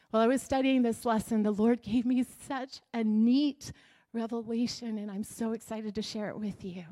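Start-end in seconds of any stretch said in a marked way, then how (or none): none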